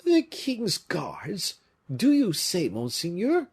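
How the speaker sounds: high, slow, alarmed question; frightened cry. A narrator's reading. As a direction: gasp it out in dread. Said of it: "cried the Bretons, growing pale".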